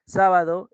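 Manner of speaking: Sábado slow, with a strong SÁ; stress on third-last syllable. Shown as a demonstration. Teacher classing a word for students, Sábado stressed on SÁ. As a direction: hit the first syllable of Sábado. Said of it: "es esdrújula".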